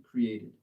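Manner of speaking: created with a crisp final D sound; clear final ed. Shown as a demonstration. Teacher modeling the ED ending for a student, crisp and clear. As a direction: land the ED on created. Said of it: "you need to have the E D sound at the end".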